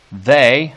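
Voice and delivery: "they" said loud with a rise; stressed they. Shown as a demonstration. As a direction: give "they" a full stress. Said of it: "you gotta give it stress there".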